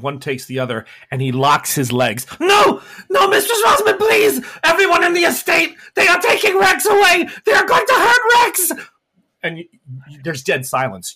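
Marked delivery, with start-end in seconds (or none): 2.4-8.7 very high, loud sobbing scream; crying scream. Acted. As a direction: wail as they drag you off. Rex dragged away crying.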